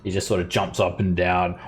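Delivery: ordinary talk, nothing marked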